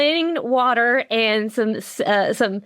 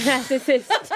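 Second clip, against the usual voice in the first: giggling scold. Acted.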